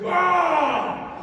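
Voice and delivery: loud, high, drawn-out war cry; roaring crew cheer. Retold or imitated. Boat crew answering their leader's yell, roaring. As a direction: let out a rallying roar. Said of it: "my boat crew just went".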